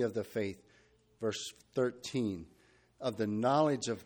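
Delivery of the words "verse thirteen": normal talking voice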